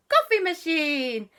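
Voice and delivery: high, slow put-on Papa voice. Storyteller doing Papa, high and slow.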